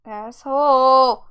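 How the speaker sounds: slow, stretched-out insult shout; loud heckle. Real, not acted. Player heckling the bossy father, loud heckle. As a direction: drag it out loud in outrage.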